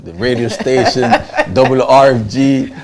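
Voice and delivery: loud, high, laughing the call letters out slowly; bursting with laughter over the station; laughing excitement. Retold or imitated. Telling the host about hearing the station, laughing loudly.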